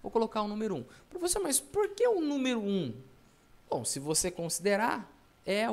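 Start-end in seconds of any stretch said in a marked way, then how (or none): 1.1-2.9 high-pitched mock pupil question; raised, put-on student voice; puzzled student. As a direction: pipe up high as a student asking.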